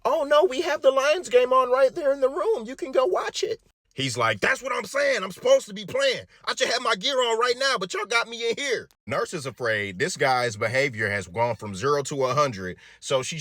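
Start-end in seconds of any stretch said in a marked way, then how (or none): 0.0-3.6 high, airy clueless nurse. Retold or imitated. Nurses reassure the patient in a high clueless voice. 4.4-8.9 a hint of a high insistent patient. Patient tells nurses he should be playing, lightly voiced insistence.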